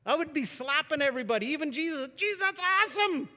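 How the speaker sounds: high, loud, bursting with joy; overjoyed whoop at Jesus; so excited. Pretend. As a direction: gush over it like you can't contain yourself. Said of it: "I'd get so excited".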